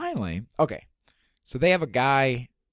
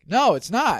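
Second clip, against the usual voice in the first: high, loud retort; retold excited contradiction; acted emphatic denial; 12 semitones higher, 6 dB louder than usual. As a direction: pounce on the magician's mistake. Acted reply to wrong card.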